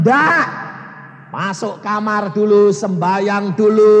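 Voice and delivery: loud, insistent, slowing down; telling family firmly to pray first, loud; firm loud refusal. Retold or imitated. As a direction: lay down the rule loudly. Insists firmly in retold scene.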